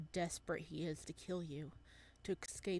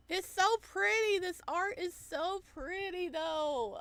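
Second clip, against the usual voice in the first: gushing; 12 semitones higher, 8 dB louder than usual. Real, not acted. Gushing over the art.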